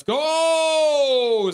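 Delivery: high, loud drawn-out "let's go"; stretched-out victory cheer; excited cheer. Retold or imitated. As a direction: cheer it like a fan. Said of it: "says a Chico".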